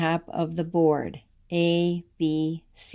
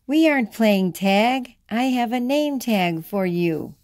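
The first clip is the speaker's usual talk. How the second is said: usual speaking voice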